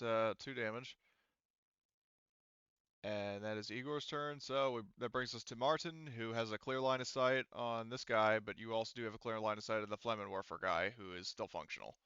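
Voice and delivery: usual speaking voice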